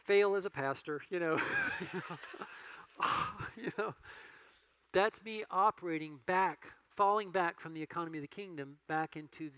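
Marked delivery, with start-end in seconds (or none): 1.5-3.5 high unvoiced rueful laugh; short airy chuckle; breathy laugh. Real, not acted. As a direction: laugh at yourself. Laughs at own failure.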